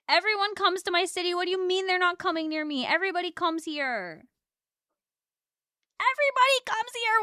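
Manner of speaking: high, loud indignant protest; high indignant cry that nobody tours here; outraged. Real, not acted. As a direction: rant in outrage. Outraged tour skips city.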